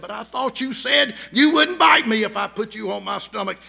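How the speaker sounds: loud, pleading outcry of betrayal; boy calls out to the snake in a loud wounded cry; anguished cry. Acted. Dying boy protests to snake.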